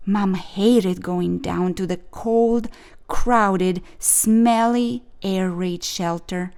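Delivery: plain reading voice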